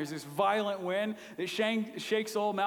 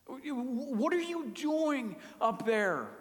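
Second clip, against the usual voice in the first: high, urgent pleading, voice straining up; desperate prayer to a silent God; pleading cry to God; 7 semitones higher than usual. Retold or imitated. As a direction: beg God to act, frustrated and desperate. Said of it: "his heart is just saying".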